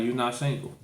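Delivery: ordinary delivery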